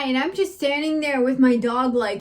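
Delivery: usual speaking voice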